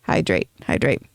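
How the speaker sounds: words round a sip of water; drinking. Real, not acted. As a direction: mouth noises while drinking. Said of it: "If you don't like mouth noises".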